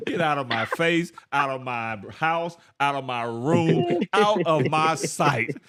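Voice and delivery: slow, drawn-out dismissal, building to a loud finish; acted attitude. Preacher acting out his listeners' attitude, mock outraged dismissal. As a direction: banish them phrase by phrase, stretching each one. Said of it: "half of you be like this".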